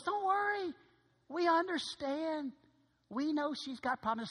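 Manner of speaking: high, gentle reassurance from the dad; soothing father. Retold or imitated. Father calmly reassures him about the nosebleeds, high soothing voice.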